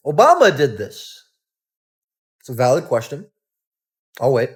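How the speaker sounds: ordinary talk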